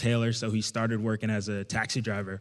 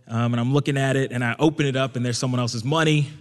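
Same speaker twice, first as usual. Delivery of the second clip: usual speaking voice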